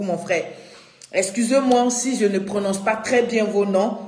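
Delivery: usual speaking voice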